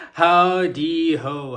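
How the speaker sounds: slow, loud, high sing-song hello; hammed-up greeting. Vlogger greeting her viewers, loud and stretched out. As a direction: stretch it out like a cheery host.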